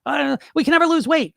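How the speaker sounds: high whiny doubt; negative inner voice. Retold or imitated. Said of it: "the dumb shit I say to myself".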